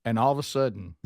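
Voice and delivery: ordinary delivery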